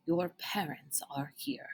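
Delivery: hushed, slow and gentle; quiet voice delivering weighty news; soft grave news. Acted. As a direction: break it to him softly.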